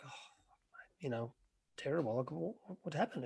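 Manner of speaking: whispered, hushed condolence; telling a friend his marriage broke up, whispered consoling; hushed sympathy. Retold or imitated. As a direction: lean in and breathe it out in sympathy. Consoling friend over bad news.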